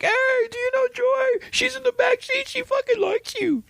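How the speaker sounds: high, put-on goofy dad voice teasing his kid; mocking dad voice. Imitating dad teasing his kid about Joy, high mock voice. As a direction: play the embarrassing dad, squeaky and teasing. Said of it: "your dad and your brother were like".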